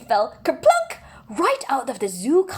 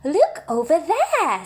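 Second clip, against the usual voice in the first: higher and slow, pointing things out; slow, higher tour-guide zookeeper; 6 semitones higher, 4 dB louder, slower than usual. Acted. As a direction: play the zookeeper showing off the park, slowly. Narrator voices the zookeeper.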